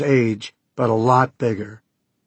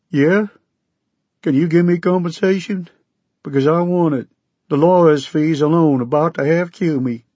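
higher, louder Okie voice, insistent; demanding Okie farmer; 7 semitones higher, 4 dB louder than usual. Acted. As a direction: push for money like a desperate farmer.

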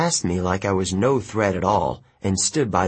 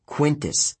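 ordinary delivery, nothing marked

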